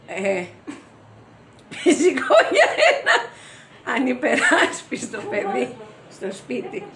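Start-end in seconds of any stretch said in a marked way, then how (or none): 1.7-6.8 high, loud burst that eases down; laughing through words. Acted. Actress repeating the line about the child, high laughing cry. Same line again, breaking up.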